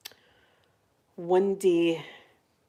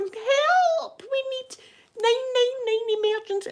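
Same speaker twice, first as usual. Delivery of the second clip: panicked call for help; slower than usual. Retold or imitated. Child's foot is bleeding, high frantic shout for a doctor. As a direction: yell for help up the hills. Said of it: "And I'm going".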